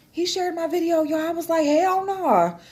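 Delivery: high, excited retelling; excited disbelief. Retold or imitated. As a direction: squeal it out in happy disbelief.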